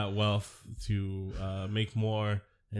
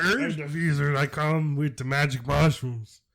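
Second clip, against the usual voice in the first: raised, hammed-up pirate accent; cartoon pirate accent; 5 semitones higher, 4 dB louder than usual. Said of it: "cartoon pirates".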